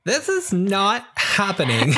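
put-on high, slow Valley Girl whine. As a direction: drawl it like an eighties Valley Girl. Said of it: "Valley Girl voice".

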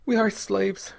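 high, resigned woman's voice; apathetically. A narrator's reading. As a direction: admit it without hope, pitched high. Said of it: "she told him apathetically".

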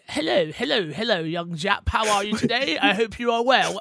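hammed-up posh British host; posh British accent. Host welcoming his co-host, posh British accent. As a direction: greet them in a put-on posh British voice. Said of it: "is that your posh, uh, British accent".

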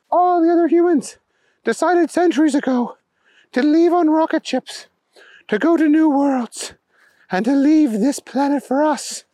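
high-pitched alien storyteller; alien king, explanatory. A narrator's reading.

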